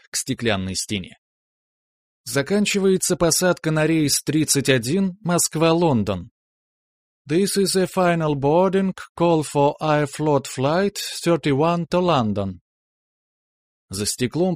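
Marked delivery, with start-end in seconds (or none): 2.2-12.6 bilingual boarding call over the PA; airport announcement. Acted.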